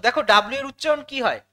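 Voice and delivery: ordinary talk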